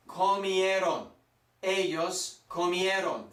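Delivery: "comieron" slowly, every vowel sounded; slow pronunciation demo of "comieron"; open-mouthed vowels. Shown as a demonstration. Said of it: "keep your mouth open".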